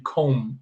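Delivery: comb, B silent, drawn out for the class. Shown as a demonstration. Teacher to students, modelling comb slowly, silent B. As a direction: say comb with no B sound at the end, slow. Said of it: "We don't pronounce the letter B".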